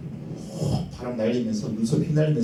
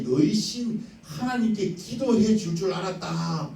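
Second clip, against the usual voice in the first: high, slow indignant complaint; indignant rant; 7 semitones higher than usual. Acted. Naaman scorning the Jordan to his men, high indignant rant. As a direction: rant with scorn about the muddy river. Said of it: "화를 내는 거예요".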